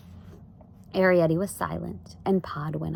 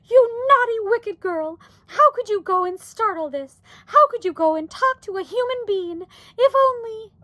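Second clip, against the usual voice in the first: high-pitched scolding wail; 7 dB louder than usual. A narrator's reading. Mother blames daughter for talking to humans, wailing. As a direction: wail the reproach, voice kept high. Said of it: "wailed Homley suddenly".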